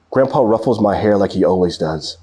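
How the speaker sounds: ordinary delivery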